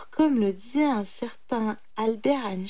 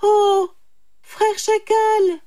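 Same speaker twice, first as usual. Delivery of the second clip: high, loud, drawn-out hail; calling out; 12 semitones higher, 9 dB louder, slower than usual. A narrator's reading. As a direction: call out to a passer-by. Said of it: "lança le sage". (interpellation forte et aiguë)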